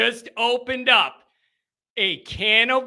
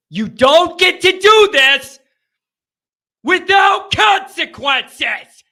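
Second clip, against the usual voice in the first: loud, high, outraged shout; building to a furious yell; angry outburst; 6 semitones higher, 8 dB louder than usual. Real, not acted. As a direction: shout it slowly and furiously at the end. Host ranting after a bad loss.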